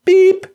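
high, loud beep; imitated machine beep. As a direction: squeak it out like an answering machine tone.

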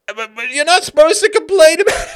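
loud, high, cracking up; sarcastic line through laughter; laughing mock quote. Host mocking the article, sarcastic laughing.

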